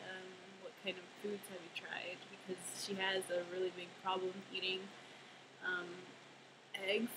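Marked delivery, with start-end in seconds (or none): 0.1-2.2 hushed whisper, no voice. Retold or imitated. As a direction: whisper the question softly. Woman asking in waiting room.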